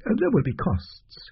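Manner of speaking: fussy little doctor. Acted. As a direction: play the oily doctor pretending it's hard. Doctor broaches money.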